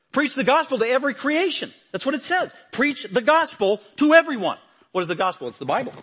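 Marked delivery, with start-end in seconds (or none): 0.1-4.6 much higher, loud pulpit command; forceful preaching. Acted. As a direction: proclaim it as a command.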